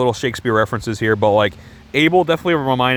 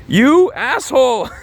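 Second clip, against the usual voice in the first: high, drawn-out cry of indignation; outraged insult; 11 semitones higher, 4 dB louder, much slower than usual. Real, not acted. Reviewer scolding the film's character, indignant. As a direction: stretch each word in exasperated outrage.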